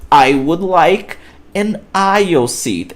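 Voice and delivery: I would like an aisle seat, slow and careful, aisle as one syllable; slow teacher demo; slow model sentence. Shown as a demonstration. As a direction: model it slowly for the class. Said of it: "essa forma que eu utilizo".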